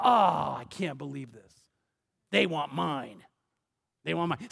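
low, deflated mock groan of disbelief; dismayed grumble. Preacher voicing a churchgoer who resents giving, low dismayed grumble. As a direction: sink from an "oh" into a low, sullen complaint.

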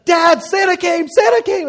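put-on high, loud, shouting kid voice; children shouting Santa came; excited kids yelling. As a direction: play the kids bursting in at dawn. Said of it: "And they're like".

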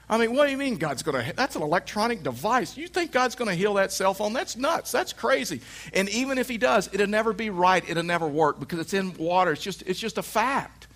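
high-pitched mock disbelief; incredulous scoffing. Husband scoffs that God will heal wife's phone, incredulous. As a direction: argue it high and exasperated, hammed up.

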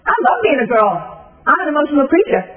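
put-on a lively, brighter quote of the girl; eager girl. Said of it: "this girl just sat up and went".

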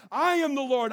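loud steady declaration of I am the Lord; proclaiming. Acted. God naming himself to Moses, firm declaration. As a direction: declare it firmly.

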